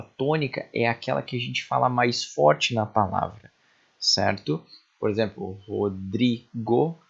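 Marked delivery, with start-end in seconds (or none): 5.6-7.0 Rodrigo very slowly in separate syllables, DRI marked; stressed syllable demo. Shown as a demonstration. Teacher showing the class the tonic syllable, syllable by syllable. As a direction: sound out Ro, DRI, go with gaps. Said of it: "o dri é a tônica".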